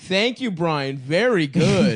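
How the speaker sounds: loud mock praise. Praising intern like a child.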